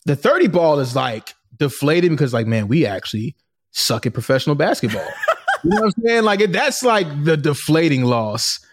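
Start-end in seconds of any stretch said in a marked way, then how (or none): 5.1-5.6 short high burst of laughter. Real, not acted. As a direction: let out a quick laugh. Laugh after joke.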